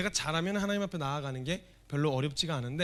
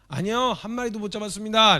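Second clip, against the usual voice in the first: higher, louder shouted reply from the boat; calling back loudly; 7 semitones higher, 9 dB louder than usual. Acted. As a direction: shout the answer back to shore. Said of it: "이렇게 얘기를 했어요".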